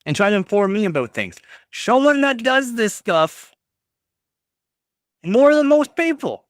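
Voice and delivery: mock the chatter in a whiny voice. Mocking a chatter's complaint.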